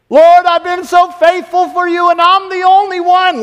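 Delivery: high, loud self-pitying whine; whining complaint to God. Retold or imitated. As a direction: wail it like a hurt martyr. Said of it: "like Elijah in the cave".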